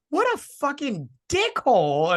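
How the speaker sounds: high, slow indignant rant; a high, drawn-out indignant grumble; outraged. Retold or imitated. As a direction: spit it out in high disbelief. Retold inner outrage.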